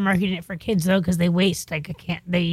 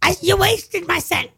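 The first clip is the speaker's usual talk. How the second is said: high, slow scolding mom; mom's voice; 10 semitones higher, slower than usual. Retold or imitated. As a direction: whine like a stingy mother. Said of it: "That's my mom's voice".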